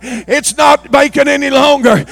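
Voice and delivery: fiery revival shouting; preaching at full force. Acted. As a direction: thunder the warning at the pews. Preacher building to a climax.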